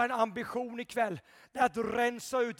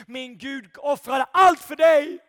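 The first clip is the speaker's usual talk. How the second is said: triumphant shout; 3 semitones higher, 13 dB louder than usual. Acted. Preacher erupting to the congregation in a loud proclamation. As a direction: burst out much louder. Bursts loud after hush.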